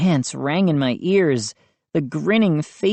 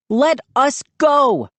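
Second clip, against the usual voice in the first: high, slow, forceful demand; 9 semitones higher, 4 dB louder than usual. A narrator's reading. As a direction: demand it word by word, pitched high. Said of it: "I demanded".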